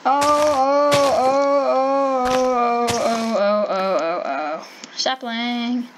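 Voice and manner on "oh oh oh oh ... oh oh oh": wailing ohs. Real, not acted. Wailing over a game setback.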